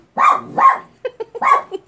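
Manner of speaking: squealing high laugh; real giggling fit; high-pitched laughter. Real, not acted. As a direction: burst out laughing at the joke.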